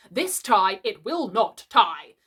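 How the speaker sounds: a man beaten by his tie; angry yell. Acted. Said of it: "he yelled".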